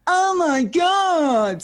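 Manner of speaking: out-squeal him, drawn right out; drunken squeal. Retold or imitated. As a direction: drunk and thrilled at one in the morning, squealing. Her drunk excited reply.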